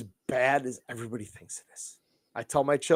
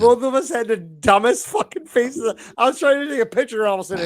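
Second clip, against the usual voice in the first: excited; 7 semitones higher, 5 dB louder, faster than usual. Real, not acted. Laughing at the frozen call.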